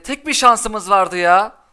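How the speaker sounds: loud, slow, drawn-out complaint; exasperated yell. Real, not acted. Streamer to his lost chance, a loud slow exasperated wail. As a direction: stretch every word out in frustration.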